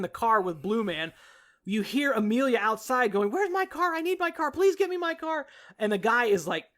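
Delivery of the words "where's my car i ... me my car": high-pitched mock plea at normal volume; imitated urgent pleading. Imitating Amelia outside asking for her car, high and urgent. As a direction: plead in a raised, insistent voice.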